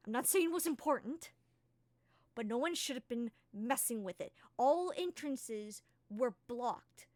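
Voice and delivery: Key snapping at James over the dragon; angry Key. Acted. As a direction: tell James off, getting heated. Key scolds James, rising anger.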